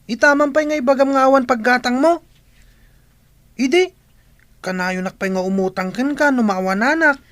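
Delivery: high, loud disbelieving retort; indignant outrage. Acted. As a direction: shriek it in outrage.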